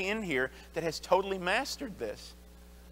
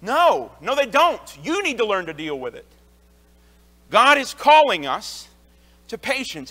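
louder, higher, driving sermon; forceful preaching; 3 semitones higher, 7 dB louder than usual. Acted. Pastor urges the congregation to be patient, preaching louder. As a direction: preach it home with conviction.

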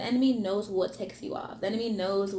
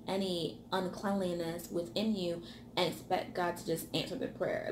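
ordinary delivery

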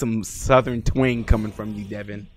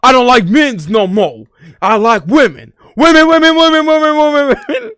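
higher, louder mock yell, building on the repeated women; imitating a friend yelling, the repeated women getting higher; 14 semitones higher, 14 dB louder than usual. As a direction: ham up his shouting, slowing on the first line. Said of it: "This is the part when he yells this".

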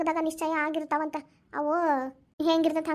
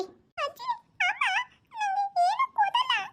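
put-on very high, slow complaining kid voice; drawn-out toddler grievance; whining child; much slower than usual. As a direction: whine pitifully to grandma. Child whines that mum gives nothing.

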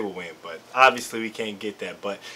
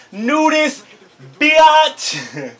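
higher, louder cocky read of the hat; mock hat slogan; 9 semitones higher, 5 dB louder, much slower than usual. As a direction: sell it like a brash slogan. Reads silly hat slogan aloud.

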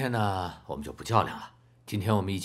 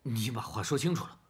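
irritated demand; 6 semitones higher than usual. Acted. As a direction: snap back in frustration. Father loses patience in quarrel.

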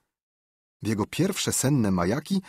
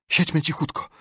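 scared urging; 8 semitones higher than usual. Acted. First take, frightened.

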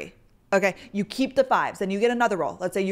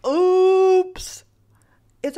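playful; 10 semitones higher, 7 dB louder than usual. Acted. Keeping mood light.

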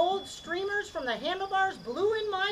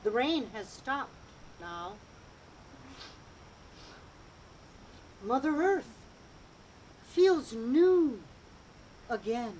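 slow, soft meditation voice; guided meditation; 4 semitones lower, 7 dB quieter, slower than usual. Acted. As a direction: ease them into calm.

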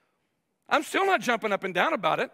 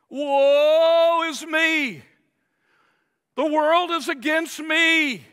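high, slow, loud mock wail of self-pity; hammed-up woe-is-me moan; whiny self-pity; 5 semitones higher, 6 dB louder, much slower than usual. Preacher mocking a self-pitying churchgoer, drawn-out whiny wail.

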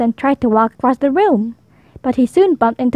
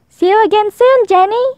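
high, raised farewell call; calling goodbye; 9 semitones higher, 3 dB louder than usual. A narrator's reading. Topsy calling goodbye to her new friend, high and loud. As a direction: call after a friend who's leaving. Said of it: "called Topsy".

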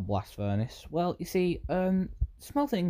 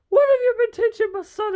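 loud, shrill, much higher outraged demand; angry parent; 9 dB louder than usual. Retold or imitated. Angry parent scolding the YouTuber, shrill mock outrage. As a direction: confront him as a furious parent. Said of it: "a bunch of angry parents just going".

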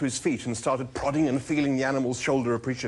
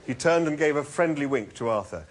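ordinary delivery, nothing marked

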